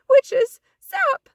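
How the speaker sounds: very high, loud panicked yell; scared shout. A narrator's reading. Froggy scared in bed, panicked shout. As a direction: scream for the witches to stop.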